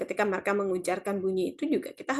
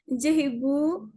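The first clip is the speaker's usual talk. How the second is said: slow, higher, deferential "Nggih, Ibu"; polite Javanese reply; 5 semitones higher, much slower than usual. Retold or imitated. Student answering the teacher in Javanese, slow and deferential. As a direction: draw it out respectfully like a student to a teacher. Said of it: "bahasa daerahnya".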